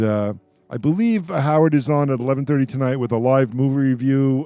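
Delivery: usual speaking voice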